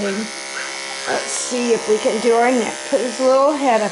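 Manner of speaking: ordinary talk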